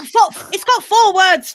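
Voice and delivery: loud, high protest; exasperated outburst. Real, not acted. Exasperated during charades guessing game.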